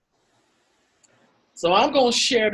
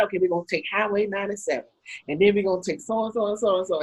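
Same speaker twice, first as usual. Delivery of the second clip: fast, softer rattling off of the route; hurried reading of directions; lightly voiced parent reading the map quickly; 4 dB quieter, much faster than usual.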